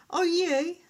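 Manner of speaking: high, startled "oh dear"; sudden dismayed surprise; surprised. A narrator's reading. Turtle realises the guest is missing, surprised. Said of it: "Franklin zrobił zdziwioną minę".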